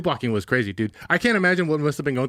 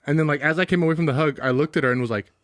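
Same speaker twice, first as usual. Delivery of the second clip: usual speaking voice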